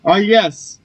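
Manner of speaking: normal talking voice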